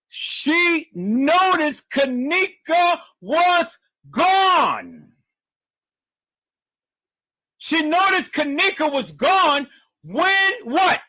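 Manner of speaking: every word high, stretched and set apart, hammering "gone" and "room"; heavy emphasis. Shown as a demonstration. Reader hammering the lie home to the audience, hugely emphatic. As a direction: belt it out slowly, high-pitched, punching "stated", "gone" and "room". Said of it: "put some emphasis upon that".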